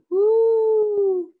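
loud high owl hoot. A narrator's reading. Owl hooting in the dark woods, loud hoot. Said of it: "an owl hooted".

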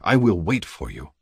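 usual speaking voice

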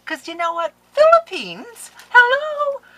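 very high, loud, building disbelief; shrieking with thrilled astonishment; excited squeal. Real, not acted. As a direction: squeal it out in amazement. Overcome with excitement about the gift.

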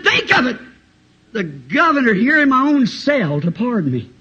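acted despairing outburst; anguished cry. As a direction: wail in disbelief at the lost chance. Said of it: "He screamed. He cried".

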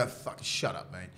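hushed, half-whispered brush-off; muttered brush-off. Retold or imitated. Player hushing a chirping teammate, under his breath.

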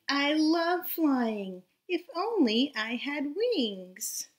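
lively storybook read-aloud voice; reading to children. A narrator's reading. Teacher reading a storybook aloud to her class, lively. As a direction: read it bright and animated for little listeners. Reads picture book to children.

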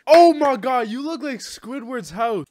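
gasp, then a loud, high exclamation; mock shock. Real, not acted. Streamer roasting a stranger's looks, mock shock. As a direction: blurt it out in exaggerated disbelief. Mocking reaction to someone's looks.

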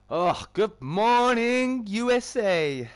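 high, loud, drawn-out morning holler; hammed-up wake-up call, stretched and loud; big shouted greeting. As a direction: belt it out slowly to a whole country. Hammed-up greeting shouted to stream viewers.

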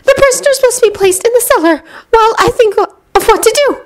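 high, tense woman's voice; uneasy, thinking aloud; Narcissa voice. A narrator's reading.

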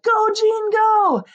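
high, cheering shout; cheering call. A narrator's reading. A kid cheering Jean on, high and loud. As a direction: call it out from the side. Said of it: "a kid called out".

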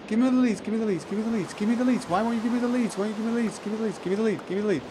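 high, insistent whine for the leads; whiny pleading. Real, not acted. Gamer begs the game for leads, high whiny pleading.

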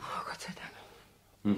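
whispered, slow and soft; relieved whisper. Acted. Fiancée whispers relief at no accident reported. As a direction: breathe it out in relief.

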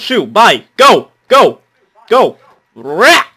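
loud, high shoo-away shouts; loudly shooing a villager off; shooing yell. Acted. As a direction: chase them off with a yell.

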